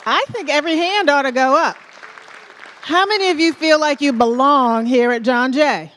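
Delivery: loud, high call to the whole hall; rallying crowd call. Real, not acted. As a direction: pump up the crowd with a loud question.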